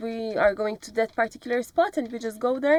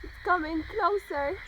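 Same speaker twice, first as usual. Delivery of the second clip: excited shout; 10 semitones higher, 4 dB quieter than usual. Real, not acted. Excited cry at something approaching.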